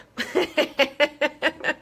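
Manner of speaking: a short amused chuckle; laughing at the blunt truth she just said; light laugh. Real, not acted. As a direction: laugh at your own bluntness. Laughs at own blunt remark.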